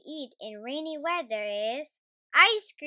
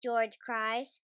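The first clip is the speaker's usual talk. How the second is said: usual speaking voice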